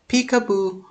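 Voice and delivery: squeaky high peekaboo. Acted. Surprise peekaboo.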